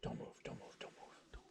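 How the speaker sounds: urgent hushed whisper. Real, not acted. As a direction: whisper it urgently, barely audible. Hunters hiding from deer.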